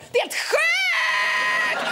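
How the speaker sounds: high, stretched squeal; hammed-up amazement. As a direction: draw the last word out into a shriek. Acts out overexcited shopper.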